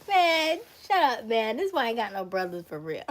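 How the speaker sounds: loud, high playful protest that speeds up and drops; hammed-up fed-up retort; mock exasperation. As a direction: fire back in joking exasperation, starting high. Acted exasperated retort to a friend.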